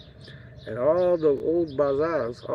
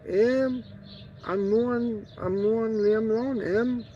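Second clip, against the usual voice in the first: much higher put-on voice, slow and drawn out; mimicking a local guy; 9 semitones higher, much slower than usual. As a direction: play a local man greeting in a high, stretched-out voice. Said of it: "He goes".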